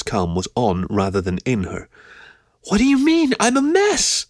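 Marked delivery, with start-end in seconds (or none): 2.6-4.3 very high-pitched indignant squeal; sharp horrified protest. A narrator's reading. Keo horrified at her own state, squealing in sharp protest. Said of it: "contrasted with her sharp tone".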